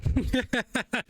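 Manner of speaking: rapid, high burst of laughter; amused cackle. Real, not acted. Host laughs at the advice.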